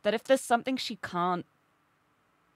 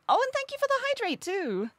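ordinary delivery

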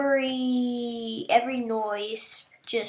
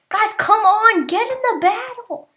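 higher and louder calling the team; urging shout; 9 semitones higher, 8 dB louder than usual. Real, not acted. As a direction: yell at your lazy teammates.

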